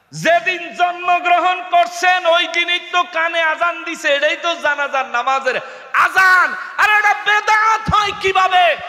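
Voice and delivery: high, loud impassioned sermon shout; preaching at full force. Real, not acted. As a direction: drive it home at the top of your voice to the crowd. (জোর গলায় উত্তেজিত ওয়াজ)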